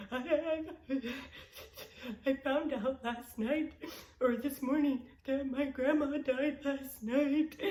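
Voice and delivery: tearful reply to the teacher; retold sobbing. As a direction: stammer it out through tears.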